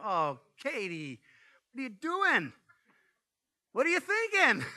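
high, exasperated scolding of the dog; exasperated dog owner. Retold or imitated. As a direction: scold the silly dog in disbelief. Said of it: "I looked at her and go".

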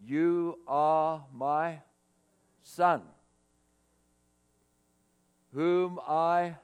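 slow, solemn voice from heaven; voice of God. Acted. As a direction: intone it slowly like God speaking. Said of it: "This is what the voice said".

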